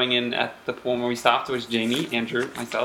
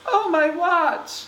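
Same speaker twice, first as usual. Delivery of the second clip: slow high wailing rabbit; much slower than usual. Acted. Rabbit laments.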